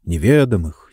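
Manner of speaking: ordinary delivery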